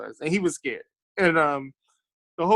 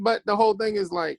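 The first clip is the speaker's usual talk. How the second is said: normal talking voice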